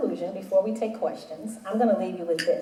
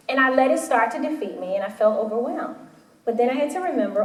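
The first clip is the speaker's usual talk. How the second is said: normal talking voice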